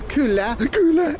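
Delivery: "Kyllä, kyllä" spoken slowly on an in-breath, high; inhaled Finnish yes. Shown as a demonstration. Host demonstrating Finnish ingressive yes, "Kyllä" said breathing in. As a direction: say "Kyllä, kyllä" while breathing in, slow and strained. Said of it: "breathe in while they talk".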